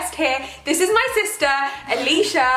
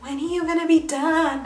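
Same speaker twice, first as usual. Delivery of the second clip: imitating her sister; 2 semitones higher than usual.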